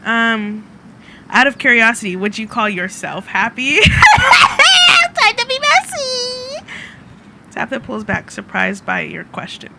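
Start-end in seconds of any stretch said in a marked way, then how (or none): 3.8-6.7 high, loud, slow laughing cry; cackle, then draw it out high; gleeful shriek. Real, not acted. Gleeful reaction to game drama.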